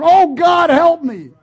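loud, high cry for help; panicked plea. Retold or imitated. Stranded driver cries out loudly to God in alarm.

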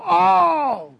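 sudden high gasp of pain; a sharp, high startled "oh". Retold or imitated. Dying woman in hospital bed, high pained gasp. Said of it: "And the woman would go".

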